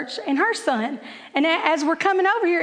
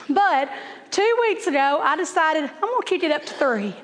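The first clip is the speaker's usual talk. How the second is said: normal talking voice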